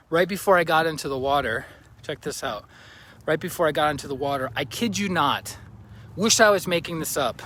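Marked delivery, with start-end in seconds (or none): none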